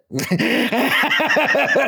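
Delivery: high burst of real laughter. Real, not acted. Laughing at his own Cheney story, high laugh.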